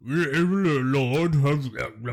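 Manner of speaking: garbled imitation of sixteen-hundreds English speech; sixteen-hundreds accent. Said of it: "Except in sixteen hundreds, they probably sound way different".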